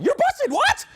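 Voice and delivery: high, squeaky hammed-up girlfriend venting; very high, slow mock rant, laughing through it; mock angry girlfriend. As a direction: play a worked-up friend egging her on, way up high. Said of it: "She gets even more angry".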